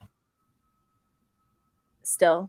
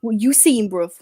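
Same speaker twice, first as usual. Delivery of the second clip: mock London roadman, a bit louder; playful roadman impression; 4 dB louder than usual. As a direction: put on a London street accent. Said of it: "Roadman voice".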